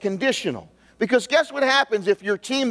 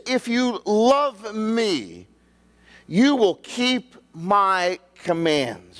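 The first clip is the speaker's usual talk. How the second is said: If you love me drawn out slowly; slow emphatic reading; 2 semitones higher, much slower than usual. Shown as a demonstration. Pastor slowly reading Jesus' words to the congregation, emphatic. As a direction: read it slowly, weighing each word. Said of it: "Very important".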